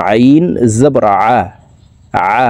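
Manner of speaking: "আ" squeezed from the middle of the throat; Ain from mid-throat. Shown as a demonstration. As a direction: spell it out, making "আ" a throaty Arabic Ain. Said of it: "আইনের মাখরাজ হলকের মধ্যখান থেকে হবে".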